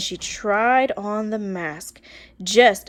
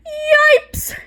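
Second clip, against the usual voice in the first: a high, loud yelp of fright; frightened cry; 7 dB louder than usual. Acted. Kids seeing Miss Swamp arrive, loud high yelp. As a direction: shriek it like scared kids. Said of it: "cried the kids".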